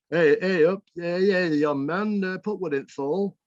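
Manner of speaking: granddad's broad Bolton dialect. Retold or imitated. Grandson retelling granddad's order in broad Bolton dialect. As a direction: sing out the old Lancashire phrasing like a northern granddad. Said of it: "my granddad might say something like".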